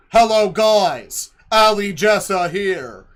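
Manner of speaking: slow, loud robotic Go Animate announcer; slow loud synthetic-voice imitation; text-to-speech voice. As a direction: mimic a cartoon text-to-speech greeting, slowly and loud. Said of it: "the way the text-to-speech".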